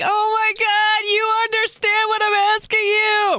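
hammed-up ecstatic mom squeal; overjoyed mock squeal. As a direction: squeal it all on one high, stretched note. Reenacts overjoyed reaction to child's answer.

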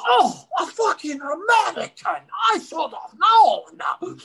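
high, loud mock policeman; disgusted cop. Cop reading an American's license, high exasperated mock shout. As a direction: imitate an exasperated cop, slowing at the end. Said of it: "He goes".

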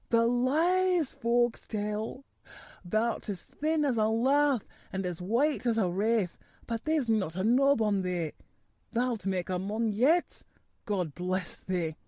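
put-on gruff old gardener, broad Yorkshire, moved; an old countryman blessing the boy; lightly voiced old gardener in broad Yorkshire. A narrator's reading.

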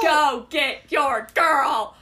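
drawn-out shouted cheer; excited cheer. Real, not acted. Co-host urging Kaz toward his girl, excited cheer. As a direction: stretch every word into a cheer.

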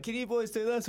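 high mock wife voice asking the boys; wife's question. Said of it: "And the wife's like".

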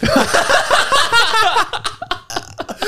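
long, very high cackle; helpless laughter. Real, not acted. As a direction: lose it at your own punchline. Cracks up at own vape joke.

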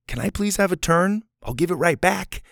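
high, kindly asking; polite request. Shown as a demonstration. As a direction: ask nicely for a turn, promising to return it. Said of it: "Do you see the difference?".

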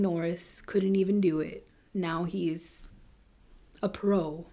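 unvoiced hushed whisper. Real, not acted. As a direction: whisper it like a secret. Hushed aside in true crime.

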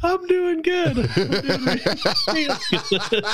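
high laughing reply; giggling through the words. Real, not acted.

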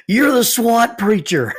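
loud, high, slow yell with a laugh; excited yell. Retold or imitated. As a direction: shout it out in sudden recognition, laughing. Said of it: "And he yells".

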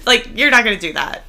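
ordinary talk, nothing marked

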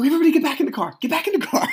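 high, loud, hurried command; panicked order. Retold or imitated. Dad ordering his kids back into the car, panicked. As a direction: herd them in urgently, voice jumping up.

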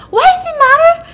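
high-pitched, loud mock shriek; hammed-up panicked yelling; freaking out. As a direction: blow up in a shrill, defensive outburst. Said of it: "she starts freaking out".

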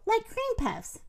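high, wistful lilt; a touch of sadness, lightly voiced; sad. A narrator's reading. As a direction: say it with mild, wistful regret. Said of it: "said M-, Minnie sadly".

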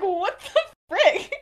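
high, loud, drawn-out disbelief; mock outrage. Sirius reacting to Regulus's accusation, mock outrage. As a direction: imitate someone appalled by an accusation. Retold shocked reply to accusation.

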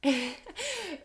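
high burst of laughter; real laugh breaking into the talk. Real, not acted. Podcast guest apologizes for an unexpected laugh, laughing. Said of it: "I'm laughing because".

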